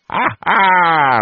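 risata breve, alta e forte; il curato risponde all'occhiata; risata complice. A narrator's reading. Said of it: "rispondeva il curato". (risatina maliziosa del curato)

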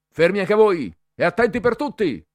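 loud, high drill shout; shouted order. A narrator's reading. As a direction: yell at the whole tent. Cadet yelling orders at boys. (il cadetto grida ordini a tutta la tenda, a piena voce)

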